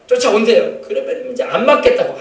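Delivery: ordinary talk, nothing marked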